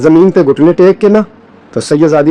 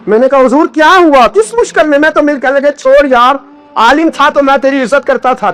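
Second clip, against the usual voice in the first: high, ringing sermon voice building up; impassioned preacher; preaching at full force; 7 semitones higher than usual. Acted. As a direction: preach it out, climbing with each line.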